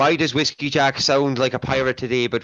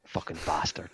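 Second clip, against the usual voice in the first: whispered under the breath, much softer; muttered curse; 9 semitones lower, 12 dB quieter than usual. Real, not acted. Sleepy streamer grumbles at friends who banned his nap, whispered. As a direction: grumble it to himself.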